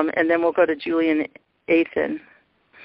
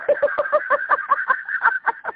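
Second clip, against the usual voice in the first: high breathy laugh. Real, not acted. Ecstatic laugh during revelation.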